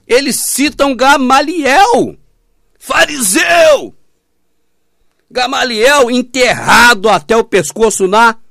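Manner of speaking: high, loud, slow shouting, fariseu yelled; preacher shouting against the Pharisee; fiery preaching. Real, not acted. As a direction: thunder it from the pulpit. Preaching at full force.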